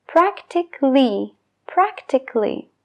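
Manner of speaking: "Practically" said prak-tik-ly, no A before the L, slow; a dropped. Shown as a demonstration. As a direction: swallow the A in "Practically". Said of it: "se deja de pronunciar". ("Practically" sin la A, praktikli)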